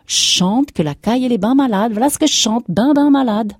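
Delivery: high, loud rustic outburst; emphatic insisting. A narrator's reading. Farmer insisting to his wife the cow is sick, emphatic.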